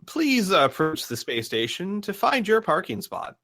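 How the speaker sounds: high, swooping station greeter voice; radio announcer. Acted. Game master as the station's radio voice greeting arriving players, high announcer. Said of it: "the voice over the radio says".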